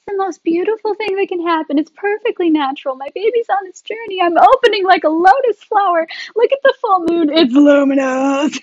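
high, swooning ecstatic imitation; hammed-up rapture, stretching the last word; mock orgasmic rapture. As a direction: gush like a blissed-out birthing woman.

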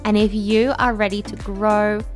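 polished upbeat show intro; podcast intro voice. Acted. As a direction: read it like a podcast opener. Podcast intro over music.

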